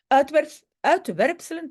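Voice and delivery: words mushed by a dental retainer; wearing a retainer. Real, not acted. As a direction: talk around a retainer in the mouth. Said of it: "avec mon appareil, difficile". (avec un appareil dentaire)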